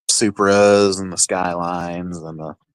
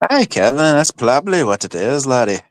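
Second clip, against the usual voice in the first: higher-pitched tipsy Irish brogue, laddie and all; Irish accent; 7 semitones higher than usual. Acted. Replying to Kevin in chat, in a thick Irish accent. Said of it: "that accent comes out super fucking heavy".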